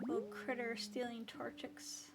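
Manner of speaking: whispering. Real, not acted. Whispered aside while gaming.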